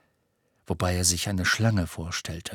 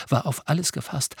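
plain reading voice

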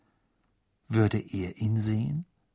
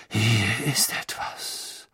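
slow, breathless seer's whisper; mysterious whisper; much slower than usual. A narrator's reading. Trelawney peering into the ball, slow mysterious whisper. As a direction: hush it as if the ball might hear. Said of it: "flüsterte Professor Trelawney".

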